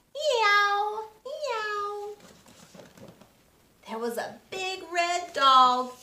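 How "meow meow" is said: tomcat meow. Acted. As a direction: let the tiger tomcat yowl.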